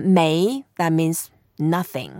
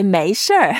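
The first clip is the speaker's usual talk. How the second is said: 没事儿 louder, with Beijing r-colored ending; Beijing accent; 6 dB louder than usual. Shown as a demonstration. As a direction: curl 没事儿 into a Beijing "shr". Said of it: "you are from Beijing area".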